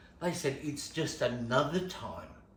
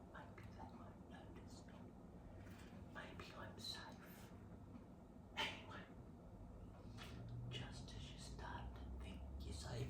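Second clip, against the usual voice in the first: hushed fearful whisper; whispering so no one hears; 5 semitones lower, 19 dB quieter than usual. Retold or imitated. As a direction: barely breathe the words. Hiding, hoping not noticed.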